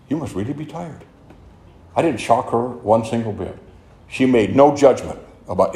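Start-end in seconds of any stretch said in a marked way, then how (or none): 0.1-1.0 soft, gentle voice of a mild, meek lady; quiet gentle sponsor. Retold or imitated. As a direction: say it softly, like the meek sponsor who is not shocked. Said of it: "but a very quiet lady".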